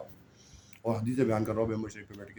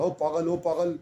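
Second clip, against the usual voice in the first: raised mock yell of a drunk boy; retold street taunt; 6 semitones higher, slower than usual. Preacher mimicking a drunk boy's jeer from the street, raised yell. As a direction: call it out down the street, jeering. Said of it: "ایک لڑکے نے آواز لگائی".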